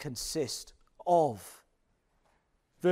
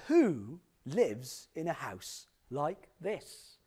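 slow, drawn-out attempt at a TV presenter's accent; Lloyd Grossman; 3 semitones lower, much slower than usual. TV host touring a mystery home, in a badly imitated drawling accent. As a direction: exaggerate the presenter's accent, stretching each word. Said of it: "I can't do his accent".

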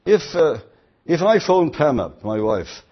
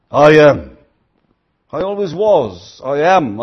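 proclaiming God's voice; 8 dB louder than usual. Acted. Preacher voicing God's words.

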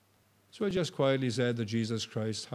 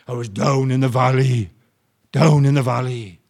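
emotional, louder, in a far-off voice; tearful; 13 dB louder than usual. Retold or imitated. Dying man telling the preacher, with emotion. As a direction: remember the valley near tears. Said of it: "And he cried".

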